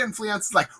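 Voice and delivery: ordinary talk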